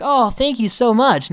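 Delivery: high-pitched, gushing imitation of thanks; put-on naive thankfulness; mock gratitude. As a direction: thank them in a hammed-up high voice. Guys' unknowing gratitude, acted out.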